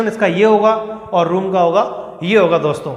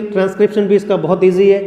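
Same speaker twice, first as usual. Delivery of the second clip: ordinary delivery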